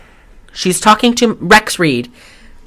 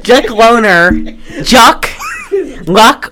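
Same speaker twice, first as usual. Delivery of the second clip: laughing flub; 5 semitones higher, slower than usual. Real, not acted. Fumbling the name, laughing.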